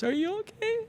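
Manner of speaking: very high, soft tearful squeak; high-pitched mock sobbing voice; imitated bawling. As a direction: mimic a bawling wife asking after her husband. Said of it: "And she's bawling".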